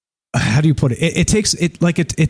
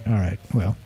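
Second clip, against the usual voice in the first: a soft, lower, deflated mumble; 5 semitones lower, 8 dB quieter than usual. Retold or imitated. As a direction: let the air out, resigned and quiet. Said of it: "I was like".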